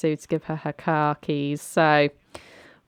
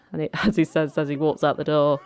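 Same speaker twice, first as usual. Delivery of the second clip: ordinary delivery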